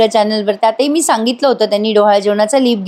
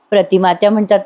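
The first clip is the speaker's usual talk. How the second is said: ordinary talk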